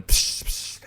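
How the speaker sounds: unvoiced "psh" smash noises; whooshed mock glass shatter; imitated smashing glass. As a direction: mimic glasses breaking.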